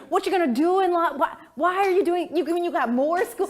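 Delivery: high, mock bewildered parent voice; baffled parents. Parents questioning her law school plans, bewildered.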